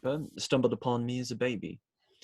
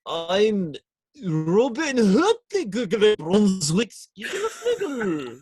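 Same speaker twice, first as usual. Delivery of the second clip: slow, higher, louder mock Welsh lilt with a laugh; Welsh accent; 9 semitones higher, 6 dB louder, slower than usual. Friend asks for his Welsh, Robin performs a mock Welsh accent. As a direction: ham up a silly Welsh voice. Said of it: "what's your Welsh sound like".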